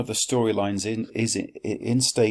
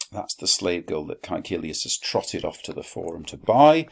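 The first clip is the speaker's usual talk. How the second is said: ordinary talk, nothing marked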